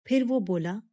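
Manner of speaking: ordinary delivery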